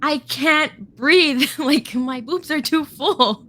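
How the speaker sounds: high, slow, breathless complaint; gasping out a mock panic. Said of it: "I was like".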